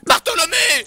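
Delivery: loud, offended correction; indignant shout. Acted. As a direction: bark the name back, offended. Corrects his shortened name.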